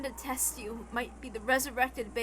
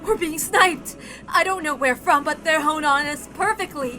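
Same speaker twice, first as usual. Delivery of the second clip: louder, urgent orders; urgent shouting; 4 semitones higher, 8 dB louder than usual. Acted. Kiri urging Kraus into the mansion, urgent shout. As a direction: bark the escape plan fast.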